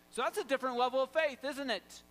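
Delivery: ordinary delivery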